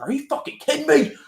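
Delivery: very high, loud disbelieving shout; imitated furious screech; mock outraged yell. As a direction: explode at the kid in mock fury. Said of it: "He's like".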